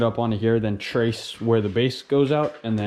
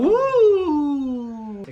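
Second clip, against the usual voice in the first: excited cheer; 13 semitones higher than usual. Real, not acted. Cheering at the coils.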